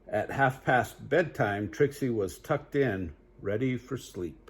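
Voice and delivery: lively storybook read-aloud; animated bedtime story voice; reading to children. Acted. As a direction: read it bright for little listeners. Reads picture book aloud.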